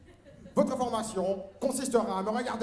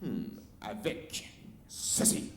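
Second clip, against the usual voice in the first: slow whispered reveal; sinister whisper; 10 semitones lower, 6 dB quieter, slower than usual. Acted. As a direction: hush it like a secret weapon. Méchant dévoile l'arme. (chuchotement sinistre)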